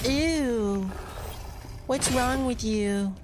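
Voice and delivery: high, squeaky mock disgust; mocking girly voice. Streamer mocking his co-host's reaction, high squeaky disgust. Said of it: "She'll be like".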